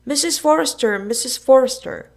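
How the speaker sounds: loud, high calling out across the yard; shouting at the top of their lungs. A narrator's reading. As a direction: yell for her to come, full voice. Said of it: "shouted at the top of their lungs".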